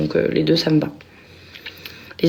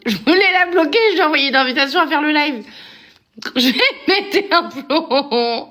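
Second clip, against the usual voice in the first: high giggly speech; laughing; 8 semitones higher than usual. Real, not acted. Explaining her mistaken live invite, giggling. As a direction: giggle while explaining the blunder.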